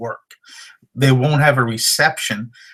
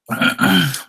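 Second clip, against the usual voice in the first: clears throat; 6 semitones higher than usual. Real, not acted. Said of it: "Losing my voice here".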